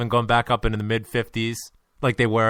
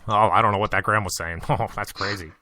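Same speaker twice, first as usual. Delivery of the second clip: mock Twitter critic; 4 semitones lower than usual. Mocking Twitter user.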